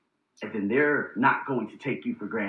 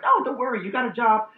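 mock reassurance; 9 semitones higher, 4 dB louder than usual. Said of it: "that positive self-talk".

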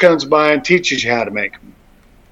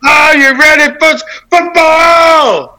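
loud, high ring-announcer call; host opening the football segment like an announcer, loud and high; announcer hype; 9 semitones higher, 4 dB louder, slower than usual. Acted. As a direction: pump up the crowd.

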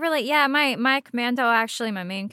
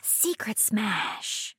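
hushed and slow; 10 dB quieter, much slower than usual. Pretend. Echoing the hushed joke.

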